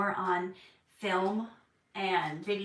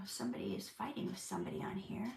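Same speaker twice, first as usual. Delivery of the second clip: whispered aside; 10 dB quieter, faster than usual. Real, not acted. Aside about chat fight, whispered.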